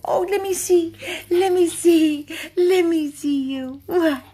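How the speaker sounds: loud, high, excited coaxing; high-pitched dog talk. Real, not acted. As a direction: squeal questions to get the dog going. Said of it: "He loves when I talk all high pitch".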